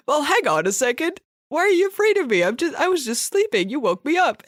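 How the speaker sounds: a hint of a kindly old man, woken and puzzled; nice old man dragon. Dragon woken by the girl, lightly voiced puzzled old man. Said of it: "this dragon is, is a sort of nice old man".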